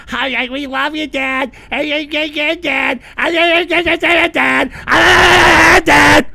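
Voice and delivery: lightly voiced whiny chatter, rising to a shout; mocking chat. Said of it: "Every time you be".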